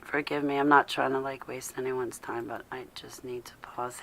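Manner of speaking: very soft, low-energy murmur; hushed and drained, trailing into breath; subdued apology. Real, not acted. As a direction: apologise quietly, fading almost to a whisper. Emotional host apologises for needing pause.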